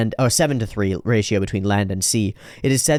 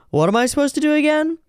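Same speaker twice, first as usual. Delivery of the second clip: high whiny voice. Acted. Voices Sports Maxx in manga.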